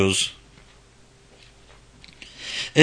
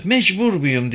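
put-on high girl's plea; reluctant girl; 7 semitones higher than usual. A narrator's reading. Girl asks Eric if she must, high uneasy voice. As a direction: ask as an uneasy young girl.

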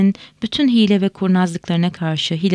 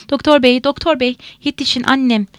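urgent, higher and louder cry for help; sobbed plea; 6 semitones higher, 5 dB louder than usual. A narrator's reading. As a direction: call the doctor desperately, narrated without real sobs. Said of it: "diye hıçkırdı". (hıçkırarak yardım çağırma)

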